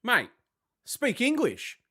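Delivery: a high, incredulous "speak English"; fed-up mock reply to a coworker; exasperated retort. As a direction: snap back at the jargon in disbelief. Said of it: "and I'm just like".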